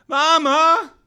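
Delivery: loud high call for Mama. Acted. Calling to mother.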